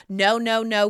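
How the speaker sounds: ordinary talk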